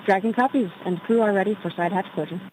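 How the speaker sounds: thin crackly radio transmission; astronaut radio. Real, not acted. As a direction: voice over a comm loop headset. Crew answering on comm loop.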